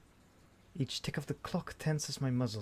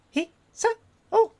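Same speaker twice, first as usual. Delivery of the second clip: high, loud voiced woman; stern, gruff female call; 9 dB louder than usual. Woman calling out from behind the student, stern. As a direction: call out sharply, pitched way up. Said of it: "A stern female voice, uh, gruffly calls out".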